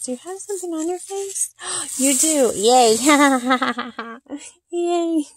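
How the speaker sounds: high, bright delighted squeals. Real, not acted. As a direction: gush over the doll's face. Baby talk to reborn doll.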